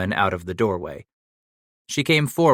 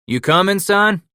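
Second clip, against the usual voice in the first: higher and louder call; 10 semitones higher, 6 dB louder than usual. A narrator's reading. Titus calling across the corral to young Paddock. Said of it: "Titus called out".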